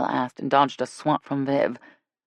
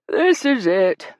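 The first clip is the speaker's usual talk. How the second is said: slow, drawn-out words through a yawn; sleepy yawn, slightly higher and louder; 6 semitones higher, 6 dB louder, much slower than usual. Acted. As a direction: say it mid-yawn, stretched out. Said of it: "said behind a yawn".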